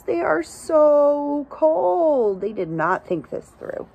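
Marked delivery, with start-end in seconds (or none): 0.0-2.4 slow, higher pitying tone; sympathetic. Acted. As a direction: drag out the pity. Pitying freezing animals.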